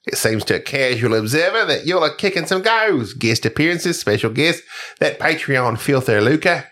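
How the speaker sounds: listener's email in an Aussie twang; Australian accent. Acted. Reading a fan's email on the podcast, Australian accent. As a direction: put on an Australian voice. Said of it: "I absolutely love doing the Australian accent".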